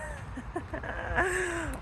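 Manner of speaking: half-sobbing, half-laughing in the cold; crying laugh. Real, not acted. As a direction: laugh that breaks toward a cry. Reacts to freezing rain.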